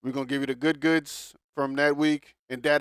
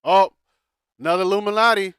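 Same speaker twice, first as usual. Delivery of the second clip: higher, louder exclaiming; mock exclaim; 6 semitones higher, 9 dB louder, faster than usual. Real, not acted. Cohost jokes loudly about the singer's photo. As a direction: tease with a loud playful exclamation.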